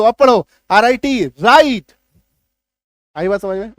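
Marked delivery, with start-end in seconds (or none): 1.3-1.9 "राइट" louder, no w, raɪt; silent w. Shown as a demonstration. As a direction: say "राइट" starting on r. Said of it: "डब्ल्यू काट दो". ("राइट" बिना डब्ल्यू के)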